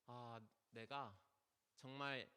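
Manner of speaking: Job's awed, hushed admission before God; humbled confession. Acted. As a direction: let it sink in slowly, barely aloud.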